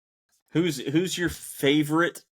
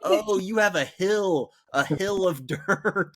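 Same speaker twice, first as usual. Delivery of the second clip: high, slow mock realization, half laughing; retold dawning realization; 7 semitones higher, slower than usual. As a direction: draw it out as it finally clicks. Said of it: "and I was like".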